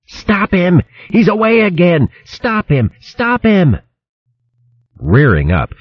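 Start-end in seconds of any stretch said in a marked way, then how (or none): 0.1-3.8 high-pitched howl of alarm. A narrator's reading. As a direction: howl the order in a high, frantic voice. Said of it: "Grottle howled".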